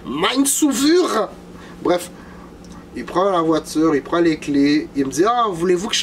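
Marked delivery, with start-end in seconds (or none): 0.1-1.3 high and very slow drawn-out call; a small high sing-song cry; little voice. Pretend. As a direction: stretch out Mind Sauveur in a tiny high voice. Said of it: "vous avez aimé ma petite voix".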